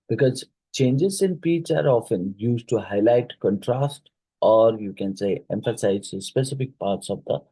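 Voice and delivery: usual speaking voice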